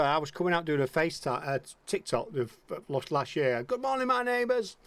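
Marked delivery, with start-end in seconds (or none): 3.6-4.8 high-pitched catchphrase call; mock calling out. Recording a TikTok outside, high mock call to the neighbours. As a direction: imitate a greeting called out, without real volume.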